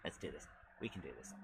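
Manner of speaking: low soft determination; quiet self-pep. Real, not acted. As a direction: murmur it low to yourself. Psyching himself up.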